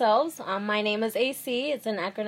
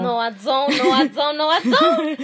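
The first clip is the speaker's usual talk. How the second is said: rising protest; 3 semitones higher, 6 dB louder than usual. Real, not acted. Co-host denies having the stronger accent, rising protest. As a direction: protest it harder each time.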